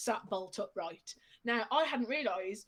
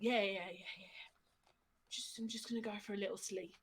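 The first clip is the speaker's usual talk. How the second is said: sleepy mumble; 3 semitones lower, 11 dB quieter, faster than usual. Retold or imitated. Drunk friend voiced going to sleep.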